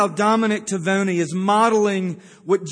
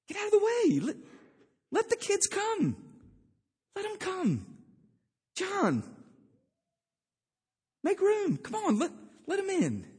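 much higher, softened mock calling out; emphatic Jesus waving people aside; 11 semitones higher, 5 dB quieter than usual. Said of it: "waving his hands and saying".

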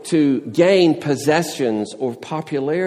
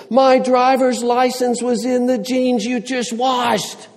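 put-on higher, louder, exasperated teen protest; red-faced complaint; 11 semitones higher, 4 dB louder than usual. Teenager accuses his mother about the washed jeans, high exasperated. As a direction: whine it at Mom like an outraged teen. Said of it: "and red-faced".

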